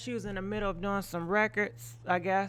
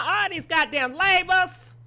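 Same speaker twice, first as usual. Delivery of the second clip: exasperated outburst; 9 semitones higher, 8 dB louder than usual. Real, not acted. Fed up with racial labels.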